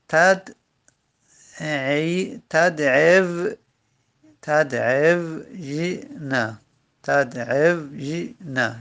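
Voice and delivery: تدعوینا split slowly as tad-'ev-yi-na; broken into syllables. Shown as a demonstration. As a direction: hold each silent consonant in تدعوینا, pausing between syllables.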